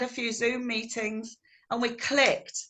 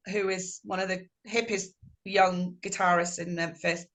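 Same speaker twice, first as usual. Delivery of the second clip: ordinary talk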